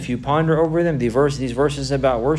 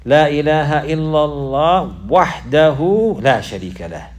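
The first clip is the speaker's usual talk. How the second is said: loud, slow stress on "La ilaha illallah wahdahu"; emphatic declaration; 4 semitones higher, 7 dB louder, slower than usual. Shown as a demonstration. Teacher demonstrating the emphasis on negation and affirmation, emphatic. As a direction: stretch "La ilaha" and "wahdahu", high and forceful. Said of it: "this statement here emphasizes the negation affirmation".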